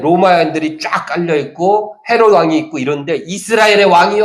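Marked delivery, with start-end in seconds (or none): 3.2-4.2 higher, excited cry of the crowd; mock crowd shouting king; crowd's acclaim. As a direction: call out like the cheering crowd. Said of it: "막 사람들이 외쳐요".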